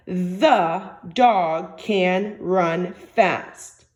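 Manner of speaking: each word spaced out, very slow; very slow dictation. Shown as a demonstration. Teacher to young students, dictating the sentence very slowly. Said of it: "Now you say it with me".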